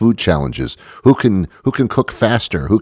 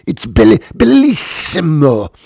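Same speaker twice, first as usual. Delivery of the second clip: mock Italian accent; 9 semitones higher, 5 dB louder than usual. Host mocking the cook again, hammed-up Italian on "bellissimo". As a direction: ham up "bellissimo" like a convulsing Italian chef. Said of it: "You said a word and you convulsed".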